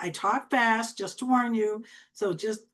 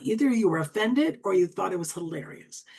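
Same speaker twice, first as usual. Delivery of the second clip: ordinary talk, nothing marked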